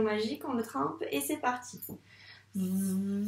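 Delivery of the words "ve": "Ve" with a very long v gliding into held e; sounded-out syllable. Shown as a demonstration. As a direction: buzz through the v of "Ve" as if tracing it. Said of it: "donc on doit le dire, les sons".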